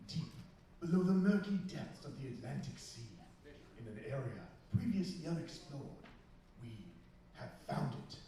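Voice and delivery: hushed dramatic trailer voice; documentary narrator. Acted. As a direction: build mystery like a film trailer. Dramatic trailer-style intro.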